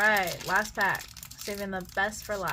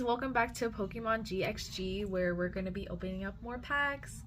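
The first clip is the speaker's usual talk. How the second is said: ordinary talk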